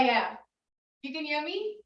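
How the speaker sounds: usual speaking voice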